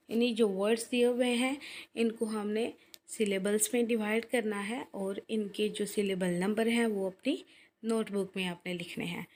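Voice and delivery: ordinary delivery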